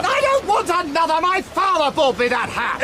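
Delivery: indignant call. Acted. Protesting lost hat over wind.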